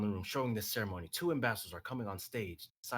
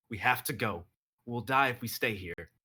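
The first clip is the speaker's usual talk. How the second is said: louder urgent plea; urging his wife to flee, loud; 2 semitones higher, 7 dB louder than usual. Acted. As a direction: push her to leave, loudly.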